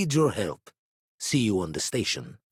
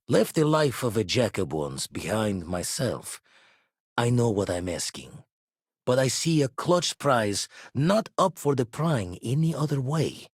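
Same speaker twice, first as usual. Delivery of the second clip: ordinary delivery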